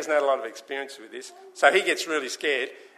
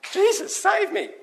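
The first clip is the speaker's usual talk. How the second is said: high, slow plea for rescue; sinking Peter begging Jesus for help; desperate cry; 3 dB louder than usual. Acted. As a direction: cry out as you go under.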